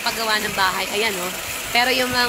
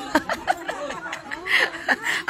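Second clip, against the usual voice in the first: breathy laugh; 6 semitones higher, 4 dB quieter than usual. Real, not acted. Laughs at own mistake.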